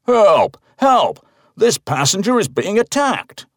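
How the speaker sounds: loud, very high cries for help; shouting for help. A narrator's reading. Old man calls for help, very high and loud. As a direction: yell it down the plane. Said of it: "The man is shouting".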